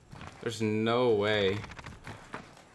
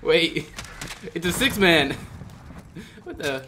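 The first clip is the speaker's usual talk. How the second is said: high, loud stunned realization; can't believe what he's seeing; disbelief; 6 semitones higher, 5 dB louder than usual. Real, not acted. Streamer realizing the lobby is a six-man, stunned.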